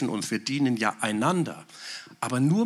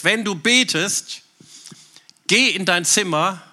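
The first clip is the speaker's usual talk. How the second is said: emphatic quote; 6 semitones higher, 7 dB louder than usual. Acted. Quoting Jesus, preached emphatically.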